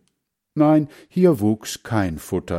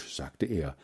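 usual speaking voice

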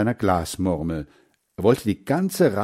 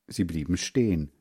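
usual speaking voice

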